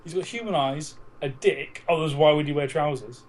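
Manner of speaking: normal talking voice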